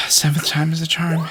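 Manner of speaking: sigh, then whisper it under your breath; exhausted, barely voiced aside; weary whisper. Real, not acted. Weary retry after many failures.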